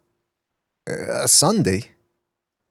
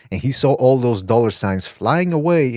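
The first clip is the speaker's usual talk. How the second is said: normal talking voice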